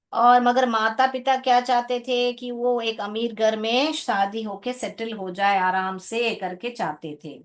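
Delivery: ordinary talk, nothing marked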